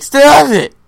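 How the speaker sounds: loud sharp yell, high; angry shout. Retold or imitated. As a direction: bark it out in a fake rage. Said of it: "Reenactment of Air when he's like".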